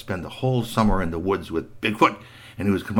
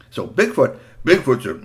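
usual speaking voice

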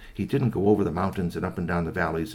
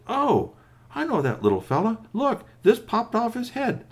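high, animated character voice; raised, eager exclamation; excited recognition; 14 semitones higher, 4 dB louder than usual. Acted. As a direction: pipe up as you recognize someone. Uncle Key recognizes the robot.

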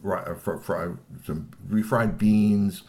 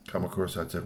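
usual speaking voice

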